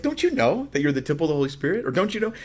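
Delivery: quick, high-pitched disbelief; incredulous questioning. Acted. Preacher plays Paul reminding believers of the temple, incredulous. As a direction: rush it upward as if they should know this.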